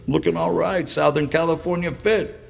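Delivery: usual speaking voice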